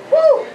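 high, loud whoop of joy; joyful woo. Real, not acted. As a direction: cheer it out. Preacher cheering meditation.

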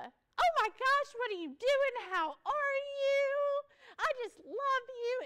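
high-pitched gushing delight; gushing Joy. Acted. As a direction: squeal it like a giddy cartoon optimist. Voicing gushing Joy character.

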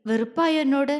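ordinary delivery, nothing marked

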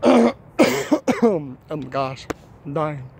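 hoarse after coughing; clogged throat. Real, not acted. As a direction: speak through a clogged throat. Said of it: "My throat was clogged".

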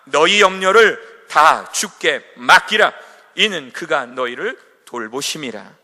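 loud, slow, solemn scripture reading. Acted. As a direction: proclaim the verse slowly and loudly. Preacher reads scripture aloud.

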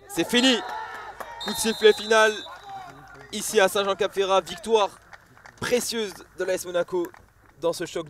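joyful call of the final whistle; excited sports commentary. Real, not acted. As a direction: announce the win loud and high.

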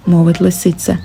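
usual speaking voice

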